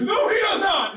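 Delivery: very high, hammed-up protest; mock indignant shout. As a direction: leap to his defense in mock outrage. Acted boyfriend defending someone's honor.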